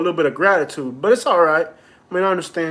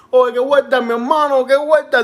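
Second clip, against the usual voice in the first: higher, louder Spanish exclamation at a friend's haircut; mock bodega guy exclaiming; 7 semitones higher, 4 dB louder than usual. Bodega guy in Spanish Harlem mocks his haircut, loud exclaiming. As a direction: play the Harlem bodega guy gawking at the cut. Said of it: "be like".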